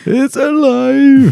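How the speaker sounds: high, very slow cry of triumph; mad scientist. Retold or imitated. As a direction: stretch it out like Frankenstein. Film quote, mock.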